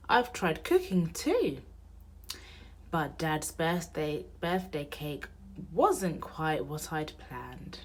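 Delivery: plain reading voice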